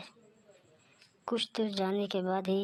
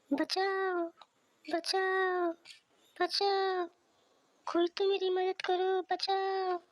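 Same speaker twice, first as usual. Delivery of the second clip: put-on very high, desperate child's cries for help; very high frightened child calling for help; 12 semitones higher, slower than usual. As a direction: cry out to anyone nearby. Boy crying for help at night.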